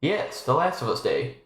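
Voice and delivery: ordinary talk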